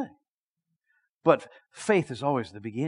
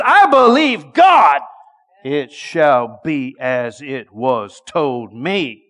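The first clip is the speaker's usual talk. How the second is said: slow, loud declaration; ringing confession of faith; proclaiming; 2 semitones lower, 9 dB louder, much slower than usual. Acted. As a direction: declare each word with faith.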